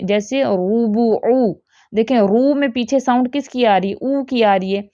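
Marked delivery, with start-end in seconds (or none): 0.4-1.7 slow "روبو او", roo-boo-oo, all vowels rounded; correct oo sound. Shown as a demonstration. Teacher giving an example of correct pesh, slow rounded oo. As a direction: draw out each oo in "روبو او". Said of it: "او جیسی sound آنی چاہیے".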